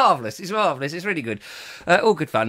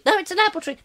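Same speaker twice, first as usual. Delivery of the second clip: squeaky reporter voice; 13 semitones higher, faster than usual. Retold or imitated.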